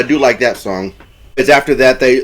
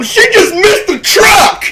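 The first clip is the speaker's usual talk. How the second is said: very high, slow shouted exclamation; hammed-up screech of amazement; crazy excited; 4 dB louder than usual. Imitating Dusty marveling at Melissa's near miss, crazy excited. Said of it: "I'm getting crazy".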